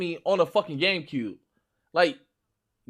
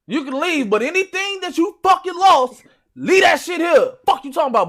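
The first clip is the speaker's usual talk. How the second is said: loud, high-pitched mock threat; trash-talk shout; 9 semitones higher, 7 dB louder than usual. Gamer warning rivals to leave what they lost, mock-menacing shout. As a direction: shout it like a hammed-up gaming threat.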